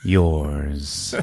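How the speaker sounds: low, halting, drawn-out threat; menacing monster. Acted. Monster looking down at the woman, slow low threat. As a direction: pause heavily between the words. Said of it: "he's like".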